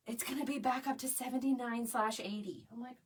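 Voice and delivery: ordinary talk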